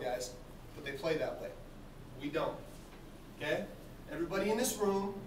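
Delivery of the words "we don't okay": normal talking voice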